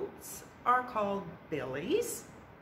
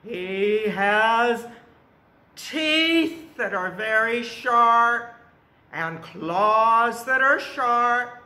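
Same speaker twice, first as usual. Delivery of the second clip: dramatic loud telling; 3 semitones higher, 8 dB louder, slower than usual. A narrator's reading. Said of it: "describing fierce troll".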